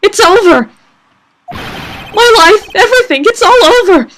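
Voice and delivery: high, loud melodramatic wailing; hammed-up shriek of despair; despairing scream. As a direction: scream as if your whole life is ruined. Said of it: "screaming over there".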